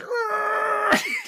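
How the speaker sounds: loud, very high-pitched wordless roar; mock charging yell. Runner spurred on by her cheer, mock charging yell. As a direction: imitate a burst of steam-powered effort.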